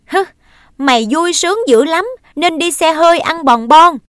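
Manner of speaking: loud, high, bitter jab; sneering outburst. Acted. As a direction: spit the taunt at her. Boy sneers at his sister.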